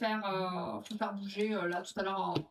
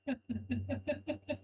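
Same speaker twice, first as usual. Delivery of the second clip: quick bubbling giggle; laughter; 2 semitones higher than usual. Real, not acted. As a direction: break into a rapid little laugh. Spontaneous laugh while hosting.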